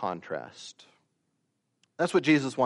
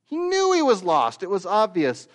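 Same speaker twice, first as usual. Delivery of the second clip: much higher and louder on knew; preaching at full force; 7 semitones higher, 6 dB louder than usual. Acted. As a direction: hammer it home that he knew.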